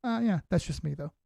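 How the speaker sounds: normal talking voice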